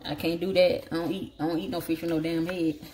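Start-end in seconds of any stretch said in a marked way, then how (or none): none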